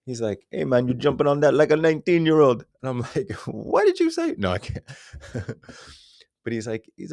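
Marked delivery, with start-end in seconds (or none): 0.5-2.7 put-on high, loud Jamaican accent; Jamaican buddy. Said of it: "my Jamaican buddy". 3.5-4.4 surprised. Retold or imitated. Mock surprised reply.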